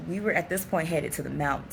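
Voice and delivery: ordinary talk, nothing marked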